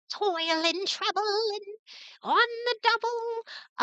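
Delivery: echoing witch. Acted. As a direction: chant it high like a witch stirring her cauldron. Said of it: "like, echo to her voice".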